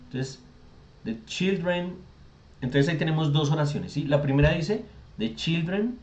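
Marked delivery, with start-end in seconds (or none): none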